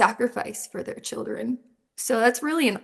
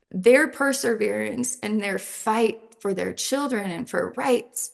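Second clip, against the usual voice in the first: ordinary delivery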